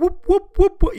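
loud, high repeated whoops; imitated helicopter rotor. Shown as a demonstration. Comic demonstrates how he heard the helicopter, high whoops. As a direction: pulse it out like rotor blades. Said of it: "that's how I heard it".

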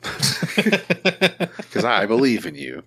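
slow, hammed-up heartfelt voice; drawn out like a cheesy pep talk; mock sincere. Mock-tender to his buddy, slow and hammed-up.

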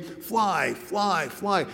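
a drawn-out call at normal volume; retold calling out. Friend guiding the running jumper, retold calling out. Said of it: "he'd start calling out".

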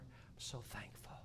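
slow, hushed whisper of gratitude; whispered thanks. Real, not acted. Preacher whispering his gratitude to the congregation. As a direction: breathe out heartfelt thanks. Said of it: "But I'm so thankful now".

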